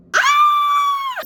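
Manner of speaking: sudden high yelp of shock; caught-off-guard shriek; startled yelp. Retold or imitated. Freezing at seeing crushes.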